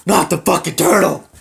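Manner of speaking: loud high mock outburst; furious yelp. Bitten wife yelling at the turtle, loud and high. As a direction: yell it as the turtle bites. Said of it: "she's like".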